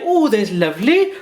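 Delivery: put-on high, slow, sing-song Welsh accent; imitating Welsh warmly; Danielle's Welsh. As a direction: say it like a fond Welsh friend. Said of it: "as Danielle would say".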